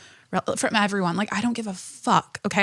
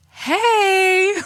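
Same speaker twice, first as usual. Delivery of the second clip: high-pitched, drawn-out playful "hey"; mock calling out; 11 semitones higher, 4 dB louder than usual. Client retorting to her photographer, playfully indignant. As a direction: sing out a high mock protest.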